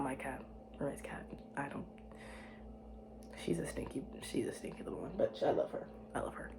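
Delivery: whispered fond tease. Real, not acted. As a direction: hush it so the cat won't hear. Whispering about roommate's cat.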